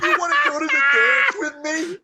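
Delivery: very high laughing shout; mock shout. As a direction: shriek it through laughter. Mocking voice, laughing.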